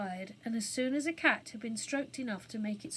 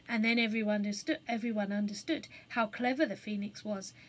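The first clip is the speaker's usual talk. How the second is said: ordinary delivery, nothing marked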